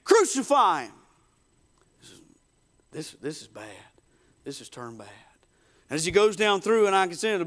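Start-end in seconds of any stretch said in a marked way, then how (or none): 0.0-0.9 angry mob cry. Acted. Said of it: "he hears again". 2.9-5.1 very soft, low, dismayed; worried murmur. Acted. Simon's worried reaction to the mob.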